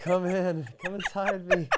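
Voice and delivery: high, laughing mock come-on; mock invitation. Friend jokingly voicing someone inviting demons, high and laughing. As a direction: camp it up, luring the creepy crawlies in.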